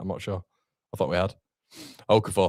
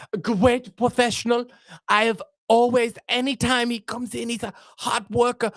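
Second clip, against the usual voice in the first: much higher put-on manager voice, doting; Daniel Farke impression; 12 semitones higher than usual. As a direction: gush over your player in a high mock voice. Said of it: "in the Daniel Farke impression".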